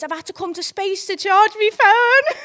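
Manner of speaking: high, loud, drawn-out impression of boyfriend; slow high wail, tipping into laughter; mock whiny excuse. Boyfriend phones from the club with his excuse, mock whiny.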